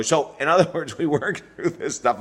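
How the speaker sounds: talking through a laugh, pitch lifted; amused, laughter breaking into the words; real chuckle while speaking. Real, not acted. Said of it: "I'm laughing now".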